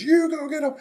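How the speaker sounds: high, pushy order; Pharaoh's order. Acted. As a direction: urge the army on.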